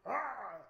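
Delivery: high, loud startled yelp. Retold or imitated. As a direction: yelp as if it jumped out at you. Reenacting being startled by creature.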